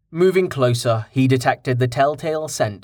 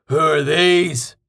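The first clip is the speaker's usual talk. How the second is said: slow, louder blunt question; 4 semitones higher, 4 dB louder, slower than usual. A narrator's reading. Carten loudly asks Rain about the strangers, blunt loud voice. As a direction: call it out bluntly to the group. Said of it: "he asked loudly".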